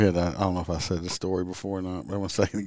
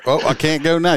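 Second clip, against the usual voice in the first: loud, higher mock surrender; mock dismay; 8 semitones higher, 6 dB louder than usual. Cop mock-conceding to a driver who made it home, mock dismay. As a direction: give up in hammed-up dismay. Mocks the idea of home base.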